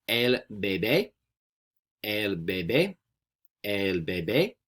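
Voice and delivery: "bebé" stressed on the last syllable, rising at the end; model pronunciation. Shown as a demonstration. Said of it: "we say".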